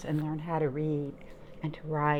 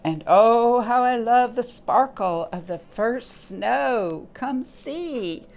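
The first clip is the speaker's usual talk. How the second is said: much louder, marveling at the snow; thrilled grasshopper; 5 semitones higher, 6 dB louder, slower than usual. Acted. Grasshopper marveling at snow to the ants, loud and thrilled. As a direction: sigh out joy at the first snow.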